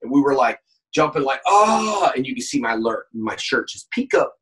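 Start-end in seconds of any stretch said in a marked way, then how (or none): 1.5-2.1 quick high yelp; mock yell. Showing the on-set jump, a sharp yelp. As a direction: yelp it like jumping into action. Demonstrating jumping yell on show.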